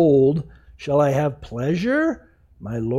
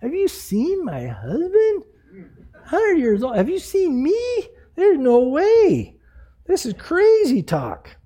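incredulous; 9 semitones higher than usual. Acted. Sarah scoffing at news of a baby, incredulous. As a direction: scoff in acted amazement, pitch soaring. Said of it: "Her laugh is a laugh of unbelief".

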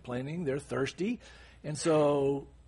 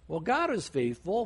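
ordinary talk

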